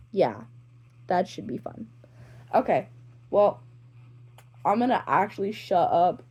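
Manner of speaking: ordinary delivery, nothing marked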